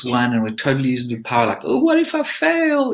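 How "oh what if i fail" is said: much higher, slow, ridiculous squeaky voice; silly strange voice. Shown as a demonstration. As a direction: ham it up like a cartoon voice. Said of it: "like, in a strange way".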